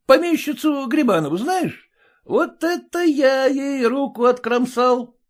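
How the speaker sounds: высокий бахвалистый пьяный голос; хвастай спьяну, смакуя слова; пьяный хвастун. A narrator's reading. (пьяное бахвальство)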